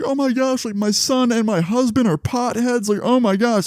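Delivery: high-pitched panicky imitation; mock freaking-out mom. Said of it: "So now she's freaking out".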